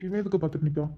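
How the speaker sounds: low, soft aside under the breath; muttered aside. Acted. Husband on a business call mutters about his wife, hushed. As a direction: mutter it so the caller can't hear.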